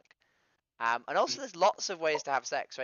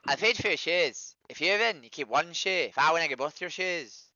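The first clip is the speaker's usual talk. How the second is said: loud, higher-pitched mock Irish accent; hammed-up Irish lilt; shitty Irish accent; 3 semitones higher, 6 dB louder than usual. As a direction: put on a bad Irish accent and project it. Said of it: "it's my shitty Irish accent".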